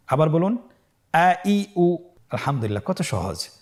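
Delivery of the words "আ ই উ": raised, measured vowel chant; separate, sustained vowel sounds; recitation drill. Shown as a demonstration. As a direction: model each vowel for learners to repeat. Repeat-after-me spelling drill.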